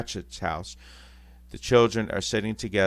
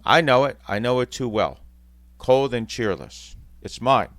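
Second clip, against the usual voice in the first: put-on a pained old miser owning up; anguished Scrooge; 4 semitones higher, 7 dB louder than usual. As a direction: say it like a man seeing his own cold room. Scrooge facing his own room.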